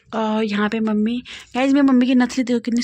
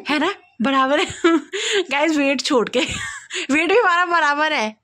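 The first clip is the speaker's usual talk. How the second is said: laughing through words; 6 semitones higher, 4 dB louder than usual. Real, not acted. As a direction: talk through real giggles, a bit higher. Joking about equal height, weight.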